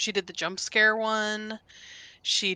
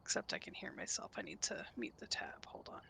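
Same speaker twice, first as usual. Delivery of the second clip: whisper; 15 dB quieter than usual. Real, not acted. Muttering to self.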